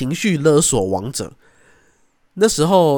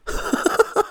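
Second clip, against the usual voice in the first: airless chuckle; breathy laugh. Real, not acted. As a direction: laugh at himself. After his story. (气声笑)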